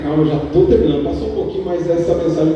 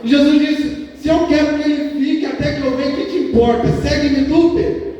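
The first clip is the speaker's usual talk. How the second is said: high, driving sermon storytelling; impassioned preaching; 10 semitones higher than usual. Real, not acted. Preacher retelling Peter's question about John, impassioned preaching. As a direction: retell it urgently in a raised pulpit voice.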